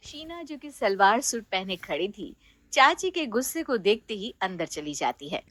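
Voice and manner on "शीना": plain reading voice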